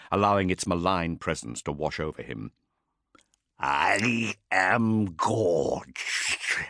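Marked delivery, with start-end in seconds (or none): 3.6-6.6 very slow, wet monstrous drawl; gurgling demon. Acted. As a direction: gurgle it out like a gorged beast. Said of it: "the thing gurgled".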